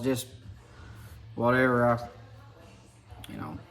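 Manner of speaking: ordinary delivery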